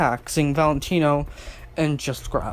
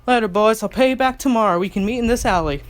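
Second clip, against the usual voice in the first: higher, louder cheeky goodbye; playful cheeky thanks; 6 semitones higher, 3 dB louder, faster than usual. Acted. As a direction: toss it off with a wink. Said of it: "she said, winking".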